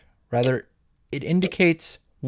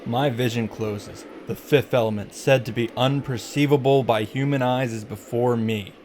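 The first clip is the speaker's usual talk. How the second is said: solemn recitation; 2 semitones lower, 4 dB quieter than usual. Acted. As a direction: recite it softly and gravely, like an incantation. Recites passage from the story.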